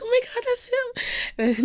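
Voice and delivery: very high, loud squeal; excited fangirl gasp. Retold or imitated. Fan pointing out her idol to a friend, excited squeal. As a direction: squeal it in sudden recognition. Acts out spotting Yang.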